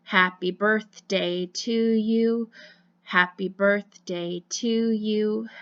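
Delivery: happy birthday spoken slowly, line by line; slow spoken song. Shown as a demonstration. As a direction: speak the song slowly, noticing each breath. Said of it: "I'll speak the happy birthday song".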